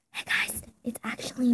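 unvoiced secret whisper. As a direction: whisper it to the camera. Aside to viewers, secret.

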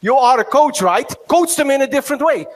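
retold exasperated telling-off; exasperated retort. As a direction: snap back at the moaning coach. His exasperated reply to the whiner.

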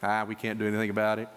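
low, quick shrug of defeat; resigned. Retold or imitated. Preacher mimicking Christians who just laid down, resigned.

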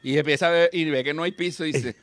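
ordinary talk

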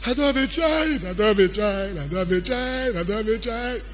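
whining, tearful complaint, imitated; put-on sobbing lament, repeated in a loop; mock crying. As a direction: wail it like a self-pitying crier. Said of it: "Little thing you are crying".

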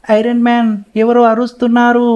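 usual speaking voice

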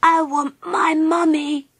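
high, slow little wail; drawn-out high sob, acted; sobbing. A narrator's reading. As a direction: wail tearfully like a small child. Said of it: "he sobbed".

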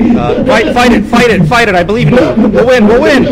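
loud high cheering on; rally the team loudly. Real, not acted. Cheering teammates on.

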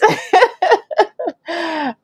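high laugh falling away; soft high laugh; amused laugh. Real, not acted. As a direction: chuckle at the joke. Laughs at own joke.

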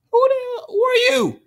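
startled high-pitched shout at the unknown player; shocked yell. Real, not acted. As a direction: screech it in disbelief.

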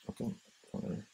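muttered under the breath, toneless and breathy; whispered shrug; mumbled dismissal. Retold or imitated. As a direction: brush it off in a sullen mumble. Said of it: "He starts mumbling to himself".

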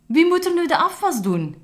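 high, loud dismayed shout; loud cry. Acted. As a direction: wail out at the broken robot. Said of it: "riep Camille luid". (Camille roept luid en hoog, ontzet)

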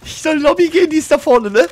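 high-pitched, indignant protest. Real, not acted. Player disputing where the lobby is, indignant. Protesting confusing callouts to teammates.

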